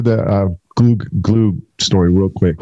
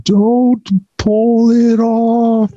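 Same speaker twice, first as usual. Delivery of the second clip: much higher, very slow stretched out warning; slow-motion shout; 12 semitones higher, much slower than usual. Retold or imitated. Warning his tech not to pull off hot glue, slow-motion high yell. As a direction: drag it out like a slow-motion replay. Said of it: "I was like, slow motion".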